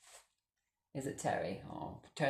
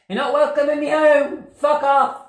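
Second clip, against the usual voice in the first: furious scream; 11 semitones higher, 10 dB louder than usual. Real, not acted. Screaming at the ghost.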